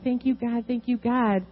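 grateful thanks. Retold or imitated. Thanking God in joy.

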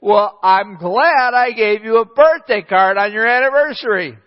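a hammed-up husband's loud sarcastic jab; sarcastic comeback. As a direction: fire back as the stung husband, mock indignant. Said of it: "And then he says".

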